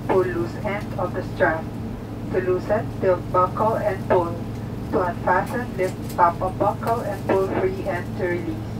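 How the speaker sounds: ordinary talk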